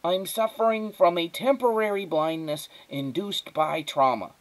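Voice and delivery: put-on pedantic child diagnosing himself; fussy, precocious boy; high, thin boy's voice. A narrator's reading. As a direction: state it gravely in a small high voice. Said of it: "His voice was high and thin".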